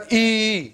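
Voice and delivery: "ای" stretched out long and loud; long double-E sound. Shown as a demonstration. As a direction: draw out "ای" as a long, held ee. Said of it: "double ای کا sound دے رہا ہے". ("ای" کو لمبا کھینچ کر)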